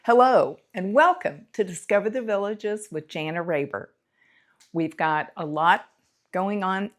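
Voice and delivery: loud, projected presenter greeting; show host intro. Real, not acted. Host welcoming viewers to the show, loud announcer-like intro. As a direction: open the show big and loud.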